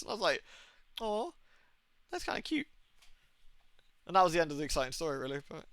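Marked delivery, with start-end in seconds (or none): 1.0-2.7 high, soft aww voice; retold smitten coo. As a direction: coo softly, as at a cute pet. Retold cooing at the possum.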